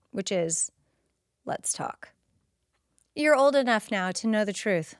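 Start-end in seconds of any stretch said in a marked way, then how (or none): 1.5-2.1 whispered, grim "Let's talk"; ominous whisper. Retold or imitated. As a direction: breathe it like a dread warning.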